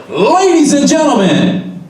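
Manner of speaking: drawn-out, high-pitched announcer call; overloud announcer. Shown as a demonstration. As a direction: blast it into the mic, far too big. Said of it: "when you're gonna speak loud, don't go".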